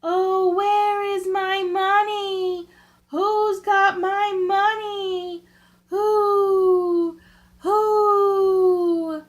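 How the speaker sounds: high, slow, crying ghost voice; dead woman's ghost wailing, rising to loud cries of "Who?". A narrator's reading. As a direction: wail from far off for her lost money. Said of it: "the ghost of the dead woman cried".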